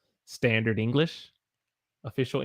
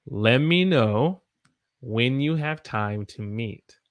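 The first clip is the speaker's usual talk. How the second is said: slow, careful model sentence; said slowly again; slower than usual. Shown as a demonstration. Teacher repeating the sentence slowly for learners. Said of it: "One more time".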